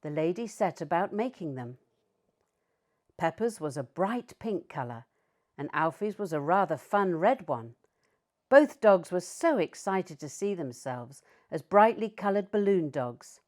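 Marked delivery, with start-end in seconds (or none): none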